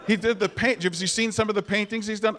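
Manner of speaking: ordinary delivery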